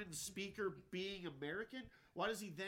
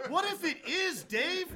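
loud, high, exaggerated protest; mock alarm; 10 semitones higher, 9 dB louder, slower than usual. Answering the sergeant's hostage joke, loud mock alarm. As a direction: pitch it way up in mock outrage.

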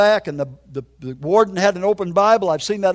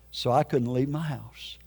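ordinary talk

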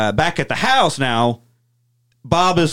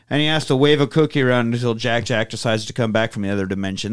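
usual speaking voice

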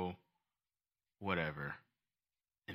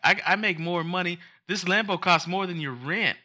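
put-on high, bragging little-girl voice; higher mock child boasting; mock bratty kid; 8 semitones higher than usual. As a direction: parody a spoiled kid showing off. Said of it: "she's like".

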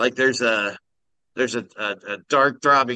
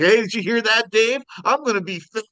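high, excited mock Minnesotan; imitated Minnesota accent, pitched way up; 11 semitones higher, faster than usual. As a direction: brag to a buddy in Minnesotan. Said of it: "talked like that. It was hilarious".

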